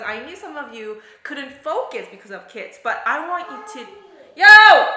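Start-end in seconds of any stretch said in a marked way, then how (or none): 4.4-4.9 sudden shout. Real, not acted. Calling out to kids mid-sentence.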